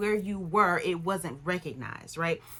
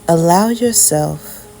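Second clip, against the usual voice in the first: calm meditative tone; 9 dB louder than usual. Acted. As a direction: lead them gently into stillness. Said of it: "I am gonna do a quick meditation".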